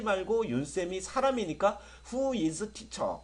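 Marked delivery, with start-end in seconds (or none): 2.1-3.2 slow model English. Shown as a demonstration. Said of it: "이렇게 말씀하시면 돼요".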